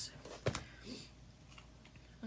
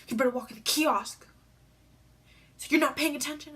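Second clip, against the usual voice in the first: louder, scolding big brother; mock scolding; 3 semitones higher, 8 dB louder, faster than usual. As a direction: scold her like a pushy brother. Brother scolding after pushing her.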